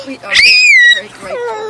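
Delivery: high, cracking with real tears; fighting back tears while thanking; tearful. Real, not acted. Thanking hosts, choking up.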